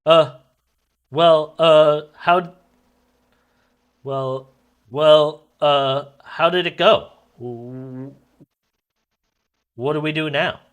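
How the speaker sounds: loud, high, hesitant stammering; nervous stammer. Acted. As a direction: stumble over the words anxiously. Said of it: "Raymond to the governor".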